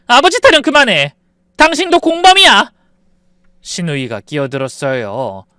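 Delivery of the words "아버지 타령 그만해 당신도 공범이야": high, loud furious shout; furious outburst. Acted. 속은 아내가 남편에게 분노해 높고 크게 소리침. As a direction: scream the betrayal at him. Wife rejects husband's excuse.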